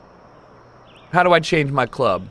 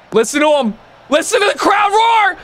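shouting. Real, not acted. Yelling at golf ball.

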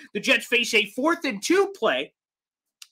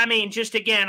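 usual speaking voice

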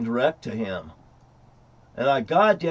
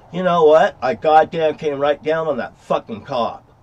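usual speaking voice

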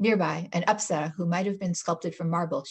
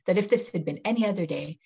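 ordinary delivery

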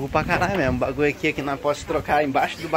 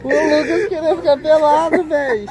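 laughing hard; 13 semitones higher, 8 dB louder, slower than usual. Real, not acted. Laughing at friend's naked idea.

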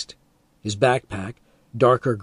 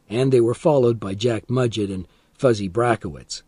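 plain reading voice

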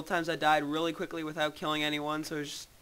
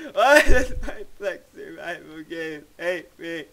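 laughing; 6 dB louder than usual. Real, not acted. Repeats complaint laughing.

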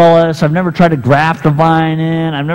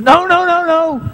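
panicked pleading; 13 semitones higher than usual. Acted. Friend begs him not to look.

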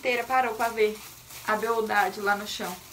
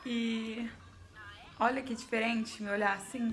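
normal talking voice

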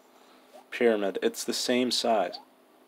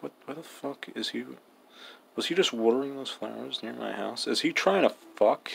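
a hint of a wary neighbour muttering; suspicious Anka; 3 semitones higher, 3 dB quieter than usual. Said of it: "She's probably suspicious".

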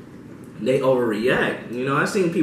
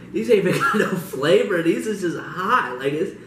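high, slowed laughing complaint; laughing through the burn; pained laughing; 7 semitones higher than usual. Real, not acted. Suffering spice, laughing.

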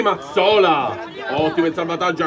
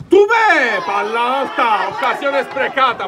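excited shout; 6 semitones higher, slower than usual. Real, not acted. Near miss, big chance.

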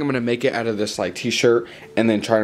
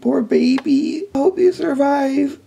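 put-on high, slow, doting coo to a baby; high sing-song baby coo; cooing baby talk; 13 semitones higher, 4 dB louder than usual. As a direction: fuss over it like a worried mom. Cooing to figure in the oven.

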